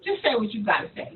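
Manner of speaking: fast, low, quiet aside; impatient listener. Retold or imitated. Voicing the person called, wanting the point, quietly impatient. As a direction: hurry them along under your voice. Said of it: "They're gonna be like".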